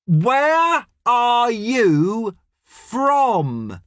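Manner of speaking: high, drawn-out shouted question; very slow, over-enunciated yell. A narrator's reading. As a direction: shout it word by word at a foreigner. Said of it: "she shouts".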